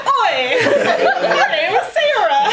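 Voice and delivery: a hammed-up English "Oi" through giggles; retelling a botched old English accent, laughing; old-timey English accent. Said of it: "with an old-timey English accent".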